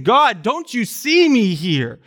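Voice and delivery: high, loud, slow plea; crying out to God. Retold or imitated. Psalmist crying out to God, loud and pleading. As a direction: voice the lonely psalmist begging God. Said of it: "crying out to God, saying".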